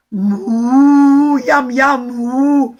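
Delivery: loud contented moo and yum; happy cow. Acted. The cow enjoys the poor man's vegetables, loud contented moos. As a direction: moo like a cow munching treats.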